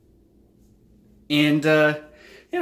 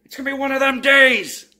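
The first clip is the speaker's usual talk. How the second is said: higher, louder hammed-up groan of frustration; mock exasperation; 10 semitones higher, 5 dB louder than usual. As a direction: overplay a bad morning, pitched up. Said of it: "And then you're like".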